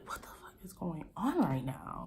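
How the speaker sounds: hushed, disbelieving whisper; shocked whisper. Retold or imitated. Reliving the fiance reveal, shocked hushed whisper. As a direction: whisper it like you can't believe it.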